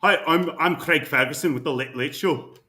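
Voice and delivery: put-on Craig Ferguson in a lightly done Scottish accent. Retrying the Scottish accent as Craig Ferguson, lightly voiced. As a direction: introduce yourself like a Scottish talk show host. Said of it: "think about Shrek".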